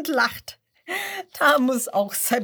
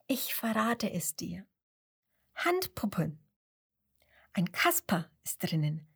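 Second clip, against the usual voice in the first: flüstern; 4 dB quieter than usual. A narrator's reading. Mama verrät den Kisteninhalt geheimnisvoll.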